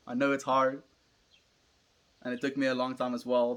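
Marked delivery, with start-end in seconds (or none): none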